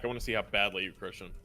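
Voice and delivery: usual speaking voice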